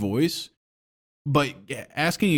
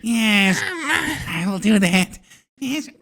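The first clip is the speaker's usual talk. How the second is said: high, slow henchman voice; Igor; 8 semitones higher, much slower than usual. Acted. Demonstrating Igor to his friend in a high-pitched voice. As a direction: play an obedient lab assistant, pitched up and drawn out. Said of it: "it is a high pitched voice".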